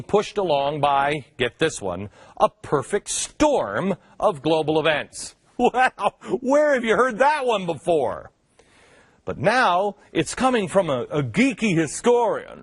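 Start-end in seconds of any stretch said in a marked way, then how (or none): 5.6-8.3 high mock-astonished wow; mock amazement. Host reacting to news he predicted, mock amazement. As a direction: exaggerate the surprise, pitched up.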